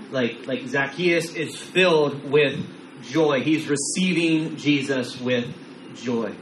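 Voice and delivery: ordinary talk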